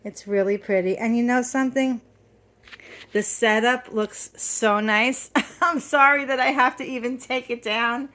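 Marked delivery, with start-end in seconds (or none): 5.5-8.1 laughing. Real, not acted. Laughs while speaking.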